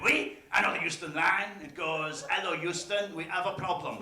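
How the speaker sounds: comic put-on French accent. Frenchman delivering his long-awaited joke to a stranger, French accent. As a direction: play a helpful Frenchman, accent laid on thick. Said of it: "He was, he was French".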